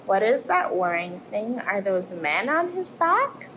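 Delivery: lively storybook voice for little ones; reading to children. Acted. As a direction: tell it like a bedtime tale, rising and falling. Reads picture book to children.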